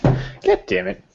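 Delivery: ordinary talk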